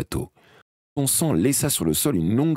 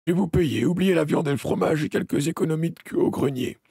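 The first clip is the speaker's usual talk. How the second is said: farmer's voice; 10 semitones higher than usual. A narrator's reading.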